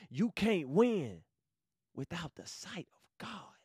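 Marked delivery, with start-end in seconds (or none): 1.9-3.6 sudden breathless hush for emphasis; whispered point. Real, not acted. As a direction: let "without the sight of God" fall to a hush. Dramatic drop to whisper.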